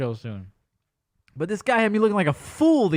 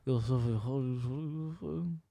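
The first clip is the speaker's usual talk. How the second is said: low, soft, slow mumble; Dre; 6 semitones lower, 10 dB quieter, slower than usual. Retold or imitated. Housemate wandering out to talk, low mumble. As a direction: smear the words into an unintelligible murmur. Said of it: "Dre will come out like".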